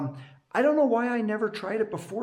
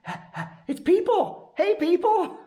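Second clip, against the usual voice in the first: high, giddy dog voice; voicing the dog; 12 semitones higher, 3 dB louder than usual. Voicing his excited dog meeting people, high and giddy. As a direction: play the overjoyed dog greeting everyone. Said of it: "he's just like".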